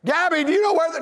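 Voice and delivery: high, pressing shout; hollering. Retold or imitated. Dad asking his daughter across the house, hollering. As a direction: keep hollering for the kid. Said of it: "I hollered out to my daughter".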